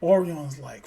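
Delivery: ordinary delivery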